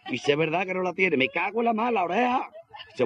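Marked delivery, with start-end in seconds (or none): 1.2-2.5 high, wailing mock dismay; lightly hammed-up despair. Worker cursing over his lost ear, high mock wail.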